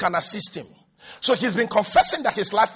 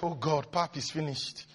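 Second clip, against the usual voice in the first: quietly imitated lament; low, soft sigh of resignation; muted dismay; 5 semitones lower, 13 dB quieter than usual. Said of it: "And you are like".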